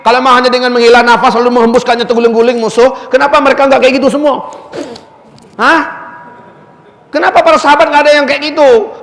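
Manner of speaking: high-pitched, insistent rhetorical questioning; indignant challenge. Retold or imitated. Preacher retelling how he challenged his friend, indignant challenge.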